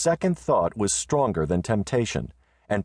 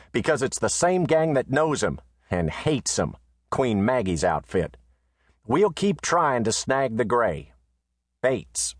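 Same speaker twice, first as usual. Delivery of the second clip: high, reporting voice; letter writer's voice; 5 semitones higher than usual. Acted. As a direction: read it as Bates would say it. Reads Bates's letter aloud.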